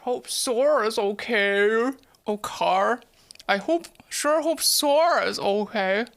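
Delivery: slow, drawn-out cartoon Goofy; Goofy impression. Streamer attempts Goofy in cursive, slow goofy voice. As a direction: stretch it out like Goofy, r-heavy vowels. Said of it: "the goofy voice".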